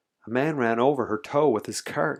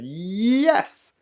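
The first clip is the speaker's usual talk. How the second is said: long Y sliding high; drawn-out, climbing comeback; stubborn insistence; 9 semitones higher, 3 dB louder than usual. A narrator's reading. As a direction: wind up the YES in retort. Man insists back at father.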